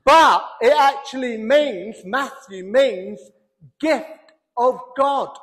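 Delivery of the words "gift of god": normal talking voice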